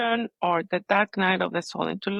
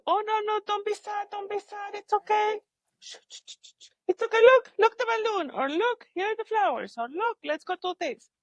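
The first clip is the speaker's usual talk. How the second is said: mock soothing of a sad child, pitched way up; imitating placating grown-up; 13 semitones higher than usual. Grown-ups distracting a sad child, high-pitched mock soothing. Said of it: "everybody tells you".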